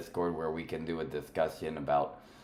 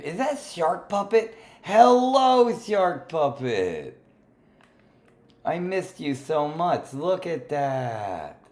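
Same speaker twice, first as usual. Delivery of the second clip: excited greeting; 10 semitones higher, 8 dB louder, slower than usual. Real, not acted. Greeting a favorite puppet.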